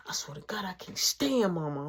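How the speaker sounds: soft hushed whisper. Retold or imitated. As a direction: complain under your breath so mama can't hear. Mark whispering complaint to brother.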